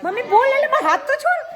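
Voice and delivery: high, loud laughing pleas. Real, not acted. Mother laughs, babies won't let go of her.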